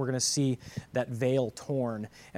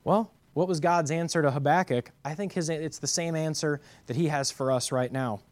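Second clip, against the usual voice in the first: normal talking voice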